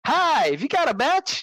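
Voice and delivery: high, put-on chirpy greeting; high-voiced imitation of a film character; mock-casual movie quote. Acting out the cheeky movie line.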